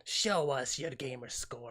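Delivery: creepy slow beckoning, half whispered; cave creature voice. Retold or imitated. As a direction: lure them into the cave. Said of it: "a voice that would, you know, take you into a cave".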